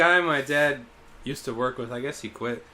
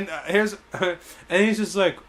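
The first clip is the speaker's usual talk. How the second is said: ordinary talk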